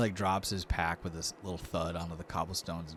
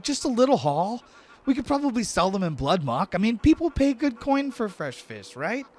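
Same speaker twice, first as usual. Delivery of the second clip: high, loud, eager pitch for a plan; eager young-sounding Lortho; 10 dB louder than usual. Acted. Lortho talks the player into selling fish, eager high voice. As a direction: push it up high and loud, coaxing.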